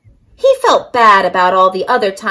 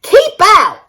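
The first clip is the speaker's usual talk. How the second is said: high, loud cactus yell; 9 semitones higher, 5 dB louder than usual. A narrator's reading. Hank yelling at the tortoise to keep out. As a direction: shout the intruder away. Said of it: "yelled Hank".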